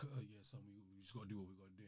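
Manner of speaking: lightly voiced worn-out dad; hushed reassurance. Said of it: "And he's like".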